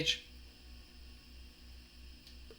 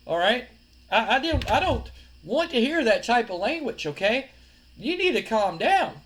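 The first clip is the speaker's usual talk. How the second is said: raised-pitch, slowed-down scolding; placating reproach; 6 semitones higher, 5 dB louder, slower than usual. Acted. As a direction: talk down a hothead, firmly placating. Rival trying to calm an angry wrestler.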